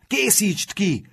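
high, drawn-out urging cry; egging-on shout. A narrator's reading. Sebastian urging Matthias to finish his rival, calling out. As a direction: urge the fighter on, slowly and high. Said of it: "kiáltott oda Sebastian".

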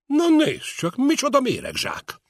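high, loud bellow of mock outrage; booming roar. A narrator's reading. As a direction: roar it out across the room. (harsogó, magas, hangos kiáltás)

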